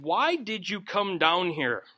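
ordinary delivery

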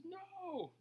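high, soft pouty no; a hint of a little girl refusing; lightly voiced toddler no; stubborn child's no.

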